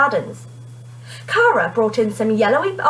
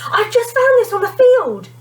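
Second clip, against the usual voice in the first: high, breathless rush after running; panting excitedly; 13 semitones higher, 5 dB louder than usual. A narrator's reading. Kerry bursts in to tell Mister Hart, out of breath. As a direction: blurt it out as if you ran all the way. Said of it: "panted Kerry".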